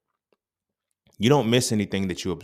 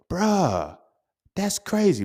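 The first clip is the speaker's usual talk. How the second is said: amazed exclamation; 11 semitones higher, 5 dB louder, much slower than usual. Retold or imitated. Retold reaction to the discovery.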